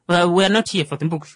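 usual speaking voice

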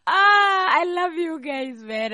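big high burst of affection, shouted; excited outburst. Real, not acted. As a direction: shout out your love for them, stretched slow.

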